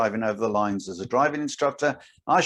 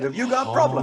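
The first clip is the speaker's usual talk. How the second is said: mock confrontation; 12 semitones higher, faster than usual. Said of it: "I think I said".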